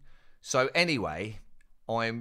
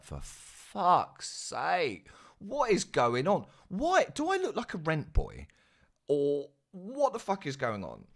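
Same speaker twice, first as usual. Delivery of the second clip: retold outraged disbelief; 8 semitones higher than usual. Propositioned by guard, retelling reaction.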